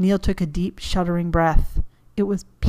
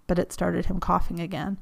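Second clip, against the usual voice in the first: plain reading voice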